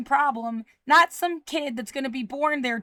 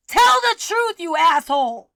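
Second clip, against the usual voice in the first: furious shout; 2 semitones higher, 6 dB louder than usual. Real, not acted. Speaker confronting those they accuse of lying, furious shout. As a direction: yell it in anger, pitched up. Real yelling at accused harassers.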